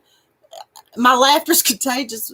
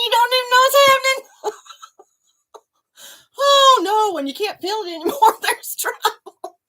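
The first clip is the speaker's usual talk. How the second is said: ordinary talk, nothing marked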